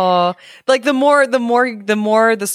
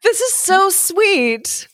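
high, slow gushing delight; 11 semitones higher, much slower than usual. Real, not acted. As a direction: coo over how sweet it is. Gushing over mermaid friends.

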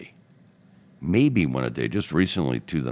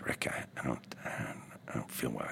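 whispered, much softer, frail and breathless; weak sickly voice; 2 semitones lower, 12 dB quieter than usual. Retold or imitated. Old friend telling Rick he feels unwell, faint whisper. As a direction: barely voice it like a sick old man.

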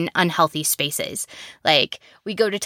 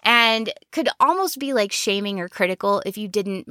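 usual speaking voice

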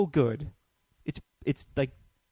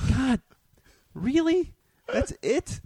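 disappointed disbelief; 13 semitones higher, slower than usual. Retold or imitated. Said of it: "So my reaction is like".

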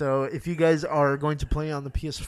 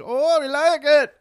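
high, slow mock Scandinavian accent; hammed-up Scandinavian accent; 13 semitones higher, 7 dB louder than usual. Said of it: "Scandinavians are like".

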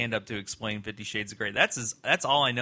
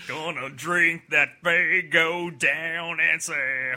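silly clown; 3 semitones higher, 4 dB louder, much slower than usual. Acted. Mocking Juggalo clowns.

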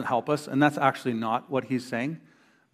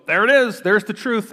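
mock triumphant; 7 semitones higher, 5 dB louder than usual. Mocking glib verse quoting.

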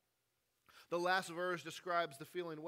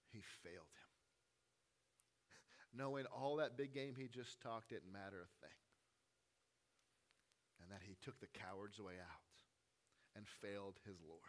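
much softer and lower, grieving; hushed sorrow; 9 semitones lower, 11 dB quieter than usual. Acted. Preacher mourning Peter's failure, hushed and low. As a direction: let it sink to a sorrowful hush.

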